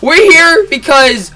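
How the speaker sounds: high, loud, impatient official voice; exasperated guard. Retold or imitated. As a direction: put on a fussy authority figure. Security guard correcting him.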